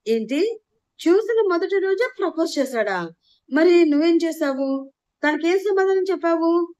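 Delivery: pepper her with curious questions; curious excitement. A narrator's reading. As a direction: sister thrilled to hear about the proposal. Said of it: "క్యూరియాసిటీగా అడిగింది".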